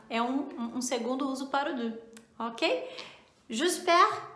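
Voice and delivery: ordinary delivery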